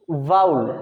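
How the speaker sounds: वाउल said low and drawn out as the answer; teacher stressing वाउल. Shown as a demonstration. Teacher tells students the answer, low and emphatic. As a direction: give वाउल as the slow, deep classroom answer. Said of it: "वाउल, स्वर है".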